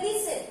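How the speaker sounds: listen said lightly, no stress on the last syllable; listen with only the first syllable stressed; easy, unstressed ending. Shown as a demonstration. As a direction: let the end of listen go easily. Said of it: "एकदम easily हम बोल जाते हैं".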